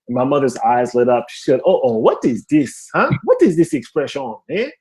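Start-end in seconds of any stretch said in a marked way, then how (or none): 1.6-4.7 put-on eager Haitian-accented mother, questions lifting; mom's thick Haitian accent. Mom delighted by a new idiom, thick Haitian accent. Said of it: "my mom has a very thick accent".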